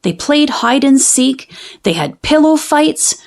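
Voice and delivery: ordinary delivery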